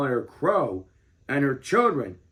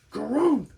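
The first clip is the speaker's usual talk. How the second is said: choking grump; 9 semitones higher than usual. A narrator's reading. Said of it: "she cried, choking on a mouthful".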